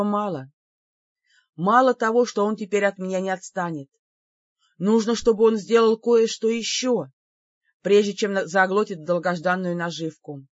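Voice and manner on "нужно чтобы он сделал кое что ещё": ordinary delivery, nothing marked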